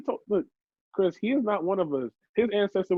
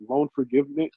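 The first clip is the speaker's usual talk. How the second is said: ordinary delivery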